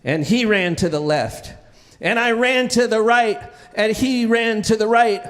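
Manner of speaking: ordinary talk, nothing marked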